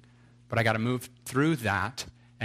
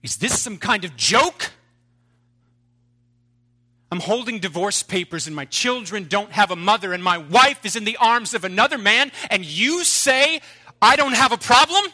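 shouting in disbelief, much higher and louder; incredulous outrage; 11 semitones higher, 13 dB louder than usual. Acted. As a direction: hurl it back at God, rising to a shriek. Husband protests to God.

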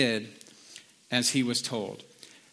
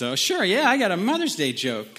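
higher, sing-song mock eagerness; eager retold reply; 6 semitones higher than usual. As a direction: play the server happily agreeing. Retold server agreeing eagerly.

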